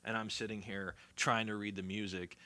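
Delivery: normal talking voice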